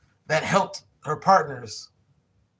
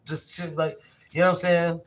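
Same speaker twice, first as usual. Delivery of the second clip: ordinary delivery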